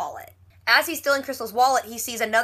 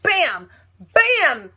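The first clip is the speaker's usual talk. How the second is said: loud, high punchy blows; playful, punched-out impact words; mock fight noises; 5 semitones higher, 6 dB louder than usual. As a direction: act out punches landing, comic-book style. Imitates blows in retold slap fight.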